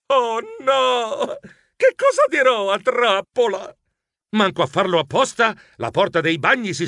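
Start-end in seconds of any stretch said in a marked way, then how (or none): 0.1-3.7 high, panicked wail; desperate cry. Acted. Geronimo finds his skateboard stolen, desperate cry. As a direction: cry out in despair. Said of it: "Lanciai un grido disperato".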